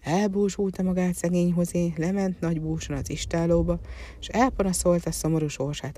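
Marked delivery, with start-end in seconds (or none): none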